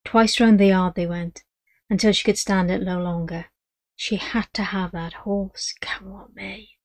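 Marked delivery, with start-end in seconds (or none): 3.9-6.7 slow, hushed whisper of desire; a whispered vow; whispered longing. A narrator's reading. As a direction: breathe it like a secret wish. Narrator whispers Madge's longing.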